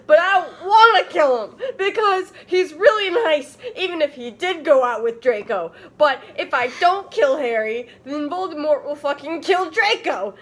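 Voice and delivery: high, loud, overwrought rant; hammed-up outburst. Ebony ranting to the band, high and loud. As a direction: ham up the teen melodrama.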